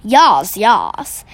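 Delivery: booming giant. A narrator's reading. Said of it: "boomed the Blood Bolter".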